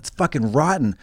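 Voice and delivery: ordinary talk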